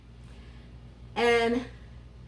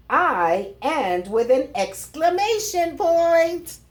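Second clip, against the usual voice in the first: louder, higher, excited and slow; excited exclamation; 4 semitones higher, 6 dB louder, slower than usual. Pretend. Teacher reading the exclamatory riddle to kids, excited. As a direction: sound thrilled to be the last sentence.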